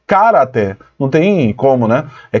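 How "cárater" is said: "caráter" stressed on the first syllable, CÁ-ra-ter; misplaced first-syllable stress in "caráter"; wrong stress on CA. Shown as a demonstration. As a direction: lean on CA in "caráter", then drop away lower. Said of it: "no K. Aí fica cara".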